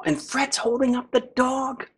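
ordinary delivery, nothing marked

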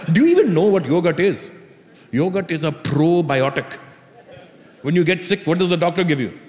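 put-on Indian-accented father explaining pompously; imitated dad, deliberate Indian-accented enunciation; lecturing Indian dad. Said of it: "My dad goes".

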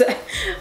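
short high embarrassed giggle. Real, not acted. Laughing after saying bum to viewers, high giggle. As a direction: let a quick giggle slip out.

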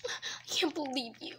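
ordinary talk

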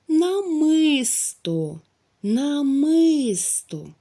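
намисто stretched slowly and loudly, на-ми-сто syllable by syllable; slow sounded-out word. Shown as a demonstration. Teacher drawing out намисто so pupils find the syllable. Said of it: "у другому складі ми чуємо".

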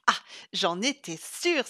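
exclamation triomphante, plus forte; s'écrie. Acted. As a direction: crier sa victoire. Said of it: "s'écria Sylvie en tapant". (Sylvie triomphe, s'écriant plus fort)

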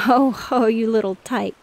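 loud, playful "caught you" exclamation; exclaiming. A narrator's reading. Father sees through his little girl's doll scheme, exclaiming. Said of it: "exclaimed Mister Brown".

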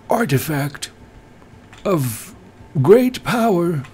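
put-on high, slow wizened old sage; old man voice. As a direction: intone like an ancient keeper of relics. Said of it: "I do a great old man impression".

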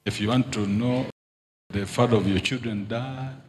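ordinary talk

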